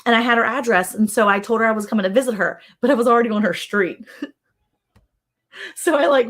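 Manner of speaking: normal talking voice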